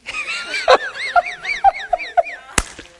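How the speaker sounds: big shrieking burst of laughter. Real, not acted. Laughing at the joyful story.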